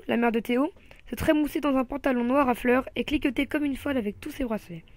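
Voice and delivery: ordinary delivery, nothing marked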